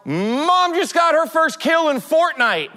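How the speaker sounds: high-pitched mock yell of sons; yelled announcement, retold in a high voice; imitated excited yell. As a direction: imitate kids hollering big news, pitch way up. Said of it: "and they yell".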